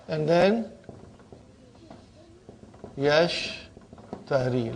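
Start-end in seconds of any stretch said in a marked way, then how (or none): 3.0-4.7 "yashtari" slow, split after the first syllable. Shown as a demonstration. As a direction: draw out "yashtari" with a pause after "yash". Sounds out present tense form.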